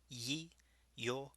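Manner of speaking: ordinary talk, nothing marked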